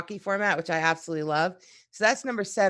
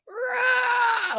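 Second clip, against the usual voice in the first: imitated furious roar, pitched way up; angry friend's roar. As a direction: let out a mock angry roar. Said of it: "he got so angry".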